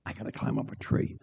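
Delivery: hushed, winded and barely voiced; acted pain. Second runner with the pain, acted breathless strain. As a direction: squeeze it out softly through a side stitch. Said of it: "gets the pain".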